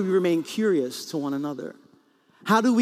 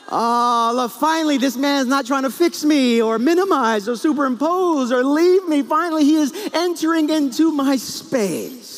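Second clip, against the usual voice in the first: high, loud, gushing mock relief; hammed-up overjoyed wife, pitched way up; wife feeling loved; 9 semitones higher, 6 dB louder than usual. Wife finally feeling understood by husband, rapturous relief. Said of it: "what my wife felt".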